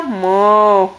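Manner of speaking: low drawn-out moo; cow moo. Shown as a demonstration. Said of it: "Can you do that, Abigail?".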